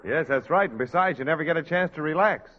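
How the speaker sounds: ordinary talk, nothing marked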